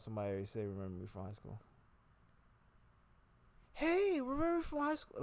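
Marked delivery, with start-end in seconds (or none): 0.7-1.6 mumbled line. Shown as a demonstration. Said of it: "I think this is how it's gonna sound". 3.7-5.2 very high, bright friendly "Hey, remember me"; sing-song high friendly reunion voice; cheery greeting. Shown as a demonstration. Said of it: "that's what I'm hoping".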